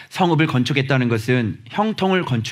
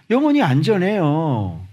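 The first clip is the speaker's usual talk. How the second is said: ordinary talk, nothing marked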